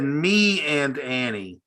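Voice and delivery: "me and Annie" said slowly, each word apart, not "mean Annie"; clearly separated. Shown as a demonstration. Correcting the host's mishearing, slowly saying "me and Annie" word by word. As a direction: spell out "me and Annie" slowly so nobody hears "mean". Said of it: "He said".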